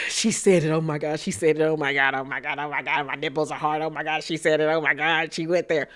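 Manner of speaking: soft, low gasping gossip voice; mimicking Erica's thrill. Mimicking Erica gasping, thrilled at the drama, hushed and low. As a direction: gasp and gush, hushed and low. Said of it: "Erica just sitting there".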